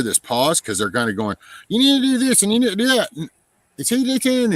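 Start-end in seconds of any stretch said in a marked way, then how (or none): 1.7-4.5 high, loud and fast mock nagging; mimicking ground crew. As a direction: rattle off orders in a shrill mock voice.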